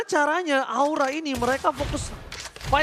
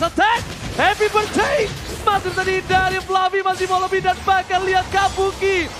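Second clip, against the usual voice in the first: high, loud hype commentary; excited shouting; 7 semitones higher, 5 dB louder than usual. Real, not acted. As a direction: yell the play as it happens. Hype play-by-play during fight.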